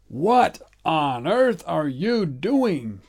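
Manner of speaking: put-on an old man aghast; old man cries out. A narrator's reading. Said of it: "cried the old man".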